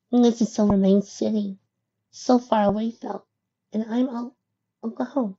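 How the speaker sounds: little lost filly about to cry, very high; filly near tears. A narrator's reading. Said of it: "She was the first one crying".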